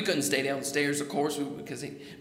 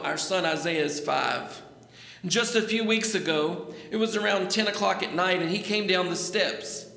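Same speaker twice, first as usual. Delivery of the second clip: normal talking voice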